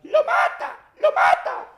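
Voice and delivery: high shrieking mock scream; lady screaming. Lady screaming at the fight, high mock shriek. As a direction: shriek it like a panicked bystander. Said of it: "la señora comenzó a gritar".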